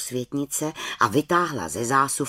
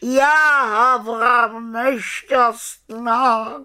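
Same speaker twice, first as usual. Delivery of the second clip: high, drawn-out wail of woe; high moaning bird voice; moaning raven; 7 semitones higher, 4 dB louder, much slower than usual. Acted. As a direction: lament loudly like a distressed raven. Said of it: "sténal dobrý Abraxas".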